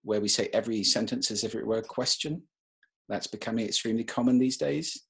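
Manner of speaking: statement lifting like a question at "question" and "days"; high rising terminal. Shown as a demonstration. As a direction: end each statement on a questioning rise. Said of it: "previous three sentences were not".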